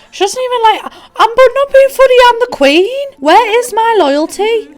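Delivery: high, loud mock outrage; indignant queen. Player as slighted queen to subject, mock indignant. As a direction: demand loyalty, hammed-up and high-pitched. Acts indignant at lack of loyalty.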